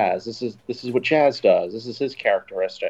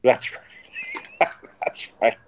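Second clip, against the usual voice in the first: voiceless, wheezy laugh through the words; breath gone with real laughter; laughing agreement; 3 semitones higher, much slower than usual. Real, not acted. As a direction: agree while cracking up. Cracking up at the joke.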